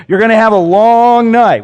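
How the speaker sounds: loud, high threat from a coach; coach's warning. Retold or imitated. Coach warning a lineman about a long night, loud and high. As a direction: growl it like a coach threatening his player. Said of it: "that's usually how they say it".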